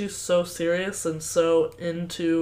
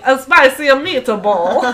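loud, slow, hammed-up Italian accent; mock Italian; 6 semitones higher, 9 dB louder, slower than usual. Host mocking the character's awful Italian, exaggerated accent. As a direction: belt it out as a cartoon Italian. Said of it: "he literally is like".

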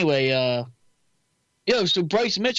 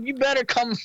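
fighter's mic callout; 7 semitones higher than usual. Retold or imitated.

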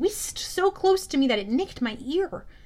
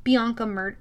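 ordinary delivery

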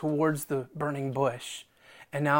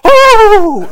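amused oh; 22 dB louder than usual. Retold or imitated. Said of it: "and he's going".

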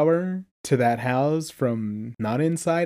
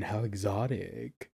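mock posh; 6 semitones lower, 12 dB quieter than usual. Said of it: "Does he say that?".

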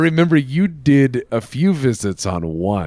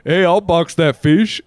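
louder, higher, drawn-out mimicked accent; imitating Boat Bomber's accent; 5 semitones higher, 5 dB louder, slower than usual. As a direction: put on a friend's accent, loud and slow. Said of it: "it's hard to do your accent".

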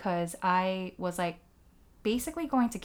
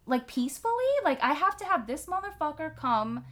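much higher, incredulous put-on voice; exasperated disbelief; 7 semitones higher than usual. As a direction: throw up your hands in mock outrage. Her own exasperated inner reaction.

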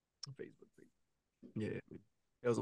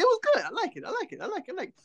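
put-on high, childlike little voice; pitched-up child saying it over and over; kid's voice; 13 semitones higher, 5 dB quieter, faster than usual. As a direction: squeak it out like a small kid. Kid answers after the movie.

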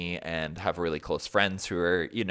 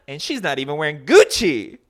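louder, higher catty put-down in a put-on voice; sassy aliens; 8 semitones higher, 10 dB louder than usual. Aliens mocking the cafe woman's outfit, sassy and louder. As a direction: mimic a bitchy alien sneering at her outfit. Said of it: "and the aliens go".

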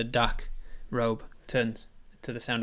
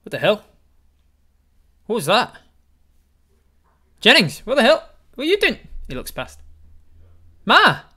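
high, loud, frantic yelling; panicked shouting; 16 dB louder than usual. Acted. Apis shouting at Jennings and Ma in panic. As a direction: shriek it out in disbelief.

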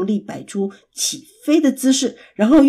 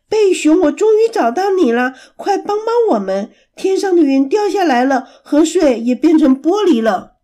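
higher and louder, calling out urgently; shouting for help; 6 semitones higher, 5 dB louder than usual. Acted. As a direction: shout across to the bear in alarm. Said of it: "古堡大喊着". (焦急地高声大喊)

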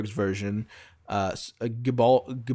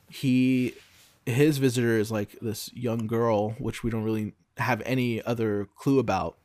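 ordinary delivery

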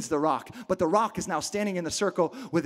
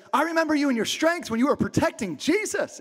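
accusing witness; 6 semitones higher than usual. Acted. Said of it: "The guy in the garden is like".

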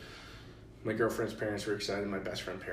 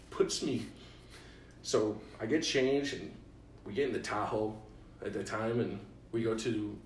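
ordinary talk

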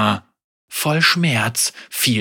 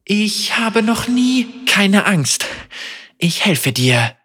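smiling through pain; 9 semitones higher, slower than usual. A narrator's reading. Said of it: "sagte sie mit einem Lächeln trotz der Qual".

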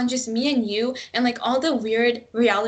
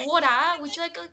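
put-on high-pitched parody accent on "water"; mocking TV-show accent; 6 semitones higher, much faster than usual. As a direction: mimic the show's accent playfully. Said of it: "Speaking of, like, accents".

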